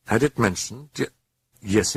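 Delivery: ordinary delivery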